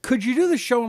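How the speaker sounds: normal talking voice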